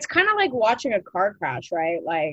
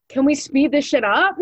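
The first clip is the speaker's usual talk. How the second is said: loud, higher-pitched impatient complaint; mock impatience; 5 semitones higher, 7 dB louder than usual. Driver stuck behind a car crash, loudly impatient. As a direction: play a fed-up driver, half laughing.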